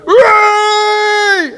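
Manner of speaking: very high, full-voiced celebratory yell; wild high whoop of celebration; football fan cheer. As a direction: cheer a goal from the stands. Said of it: "when you're at a football match".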